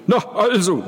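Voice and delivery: high, loud, forced brightness; hammed-up cheer that rings hollow; false cheer. A narrator's reading. Father masks unease with forced cheer, high and loud. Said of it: "mit einer falschen Fröhlichkeit".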